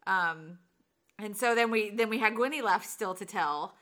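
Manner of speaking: ordinary talk, nothing marked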